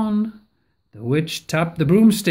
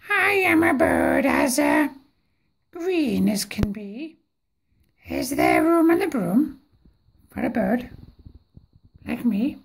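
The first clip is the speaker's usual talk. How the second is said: polite bird; 4 semitones higher than usual. A narrator's reading. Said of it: "then said".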